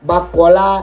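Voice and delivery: ordinary delivery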